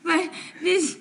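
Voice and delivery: giggling through the words; can't stop laughing. Acted. Mary laughing at Merle stumbling, giggling through it.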